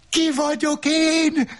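very high screamed question; strained, high-pitched howl. A narrator's reading. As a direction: scream it as a furious challenge. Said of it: "Üvöltötte".

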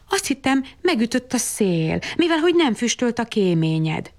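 plain reading voice

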